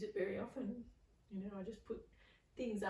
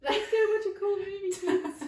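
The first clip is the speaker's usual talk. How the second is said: high, loud mock grown-up invitation; playful imitation of a friend's parent calling the kids; adult luring kids; 12 semitones higher, 12 dB louder than usual. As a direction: chirp it like a too-keen family friend. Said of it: "One of your family friends".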